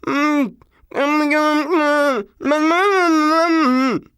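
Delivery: high, loud muffled mm grunts through stuck lips. A narrator's reading. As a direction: hum desperate cries through a closed mouth. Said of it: "what came out was".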